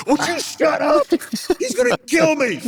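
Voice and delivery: high, strained mock panic; panicked driver. Teddy scared to death as the soaked friend sits behind, high panicked plea. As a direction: squeal it out like you're terrified. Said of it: "And Teddy goes".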